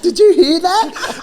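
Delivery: high, slow, laughing squeal; laughing in disbelief at the daughter's comeback. Retold or imitated. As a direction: crack up while asking. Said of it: "I'm like".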